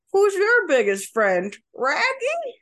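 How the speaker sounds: high, slow, doggy-cute Scooby-Doo growl-talk; cute cartoon dog voice. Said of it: "it's gotta have that cuteness".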